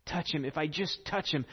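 soft inward murmur, repeating the hope; hushed, pleading self-talk; murmured to herself. Retold or imitated. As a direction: say it under your breath to yourself. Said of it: "she kept saying to herself".

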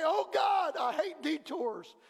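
exasperated plea to God; frustrated cry. Pretend. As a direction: cry out at the detour. Pastor voicing frustrated prayer.

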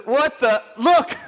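stunned discovery; astonished. Acted. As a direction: blurt it out in amazement, pitch jumping up. Acting out astonished leper noticing healing.

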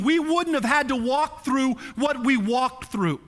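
mild mock yell. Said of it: "wanted to look at Jesus and yell this at him".

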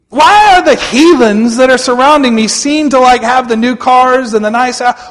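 loud, high-pitched questioning of God; aggrieved complaint. Acted. Acts out believer's complaint to God.

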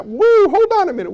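high, loud "woo" bursting out; excited woo. Real, not acted. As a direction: break in with a startled whoop. Preacher's sudden exclamation.

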